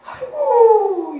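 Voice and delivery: high, drawn-out exasperated aigo. Real, not acted. Preacher lamenting to the congregation, drawn-out dismay. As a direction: stretch it out in dismay.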